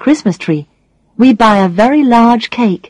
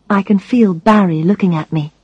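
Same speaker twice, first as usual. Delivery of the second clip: usual speaking voice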